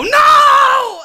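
high, loud shriek; kid's shout. Retold or imitated. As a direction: scream it like a toddler. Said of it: "and I would go".

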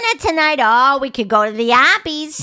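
put-on teasing Boston accent parody. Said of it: "the worst accent in all of the United States".

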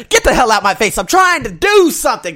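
loud, high-pitched angry retort; exasperated shout. Acted. Runner to accusing onlookers, exasperated shout. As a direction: shout them off, fed up.

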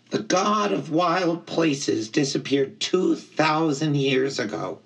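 high-pitched, earnest satyr; Grover, solemn storyteller. A narrator's reading. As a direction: tell the legend earnestly in a high voice. Grover tells the legend.

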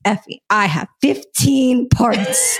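slow, drawn-out excited shout; excited announcement. Retold or imitated. Telling Effie she has fifteen parts, excited slow shout. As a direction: call it out slowly like big news. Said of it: "I was like".

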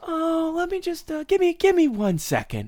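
high, overly nice customer voice; customer service voice. Retold or imitated. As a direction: sweeten it way up like a polite customer. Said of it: "it's like their customer service voice".

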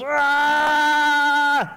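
put-on very high, loud drawn-out kid scream; frantic kid scream. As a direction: let out a long frustrated scream. Running around in circles.

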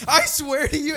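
high, loud frightened protest; panicked insistence. Retold or imitated. Scared friend insisting to Caleb in the dark, panicked. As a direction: swear it in acted alarm.

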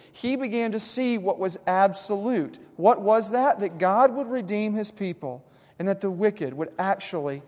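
loud, driving sermon cadence; preaching at full force. Acted. Pastor preaching the psalm's turn to the congregation. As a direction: press the point hard from the pulpit.

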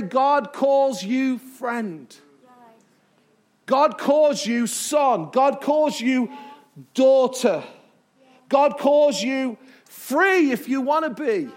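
slow, each phrase weighed, stress on calls; slow emphatic preaching. Real, not acted. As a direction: hammer home calls, slowly.

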